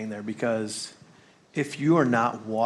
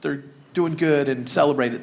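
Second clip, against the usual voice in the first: breezy generic cheer; 5 semitones higher, 5 dB louder than usual. Retold or imitated. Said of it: "It's not just like".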